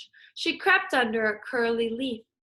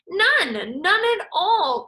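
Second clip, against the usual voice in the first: loud, high protest; cried out; 8 semitones higher, 6 dB louder, slower than usual. Acted. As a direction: cry out in alarm. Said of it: "cried the iguana".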